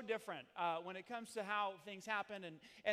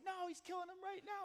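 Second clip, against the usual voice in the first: a lightly voiced, higher panicky kid; son yelling; 12 semitones higher than usual. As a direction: imitate the son calling out in alarm, only lightly. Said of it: "he started yelling and screaming".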